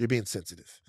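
imitating a dismissive doubter, low and soft; mock-dismissive, low and hushed; skeptical dismissal. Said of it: "people think".

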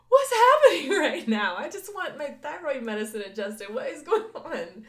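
high, loud, laughing mock panic; laughing disbelief. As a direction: squeal it out between laughs.

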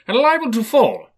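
high, loud frightened protest; alarmed remonstrance. A narrator's reading. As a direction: plead in alarm, voice leaping up. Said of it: "Scrooge remonstrated".

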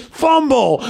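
high-pitched shouted ruling; referee's call. Retold or imitated. Referee turning round to make the call, high shout.

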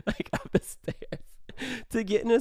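breathy, laughed-out words; laughing. Real, not acted. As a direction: let a laugh swallow the words. Laughing while telling of the shove.

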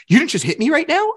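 high, incredulous imitated accusation; mock accusing. Voicing the ex's staged accusation.

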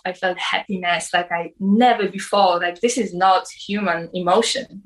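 normal talking voice

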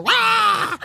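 alarido muy agudo imitando un cerdo acuchillado; chillido de marrano. Retold or imitated. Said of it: "gritó tan horrible como marrano". (grito horrible imitado, agudísimo)

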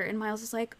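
normal talking voice

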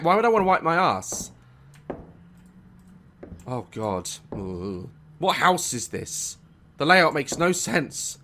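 ordinary talk, nothing marked

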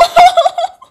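high squealing laugh; helpless high laugh; loud burst of laughter. Real, not acted. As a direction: crack up loudly at the joke. Laughing at own story.

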